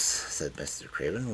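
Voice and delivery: usual speaking voice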